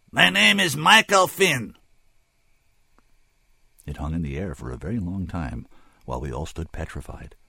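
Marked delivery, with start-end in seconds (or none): 0.1-1.8 high, loud, slow alien announcement; clear as a bell. A narrator's reading. Alien finally telling the barkeep his name, clear as a bell. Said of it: "clear as a bell".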